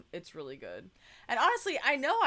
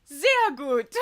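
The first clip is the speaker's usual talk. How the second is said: put-on a crisp, kind "Sehr gut" in a German accent, said higher and louder; imitating the German clerk; 6 semitones higher, 8 dB louder than usual. The cashier praising the partner's German, a German accented "Sehr gut". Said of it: "she goes".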